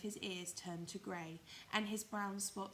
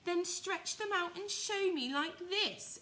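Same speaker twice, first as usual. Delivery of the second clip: higher, louder, slow daring rabbit; high loud challenging wild rabbit; 11 semitones higher, 7 dB louder, slower than usual. Acted. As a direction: dare him, high and drawn out like a bunny. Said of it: "said the wild rabbit".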